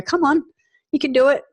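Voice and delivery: high, loud cheering encouragement; rally a friend who's struggling, bright and loud. Retold or imitated. Supportive friend urging someone after a hard time, high cheering call. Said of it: "who are just gonna go".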